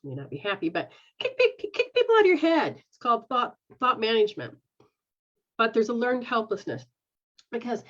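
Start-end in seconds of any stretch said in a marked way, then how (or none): 1.2-2.8 high, louder push to kick them out; emphatic urging. Acted. Urging the boy to kick people out of his head, emphatic and high.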